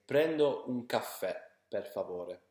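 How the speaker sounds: Prendo un caffè per favore, slow Italian with a tapped r; Italian phrase demo. Shown as a demonstration. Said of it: "the simplest way to do it is say".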